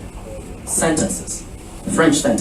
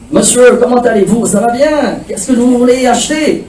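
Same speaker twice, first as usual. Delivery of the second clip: a chatty French shopkeeper, higher and brisk; 7 semitones higher than usual. Retold or imitated. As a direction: rattle on like a friendly vendor. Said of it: "And he began to".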